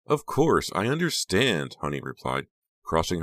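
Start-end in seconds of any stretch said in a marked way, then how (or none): 0.1-1.8 high, drawn-out show of understanding; gushing, solicitous friend. A narrator's reading. Honey answering reluctant Becca, effusively understanding. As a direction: stretch out the sympathy up high.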